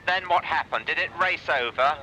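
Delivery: ordinary talk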